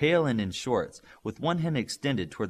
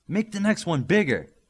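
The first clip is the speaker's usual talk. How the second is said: higher, louder call from down the beach; distant shout; 8 semitones higher, 6 dB louder than usual. Acted. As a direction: shout it from far off. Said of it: "they heard in the distance".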